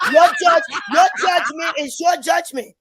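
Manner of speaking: breathless, gasping between words; out of breath while arguing; hyperventilating. Real, not acted. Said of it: "You just sound like you hyperventilating".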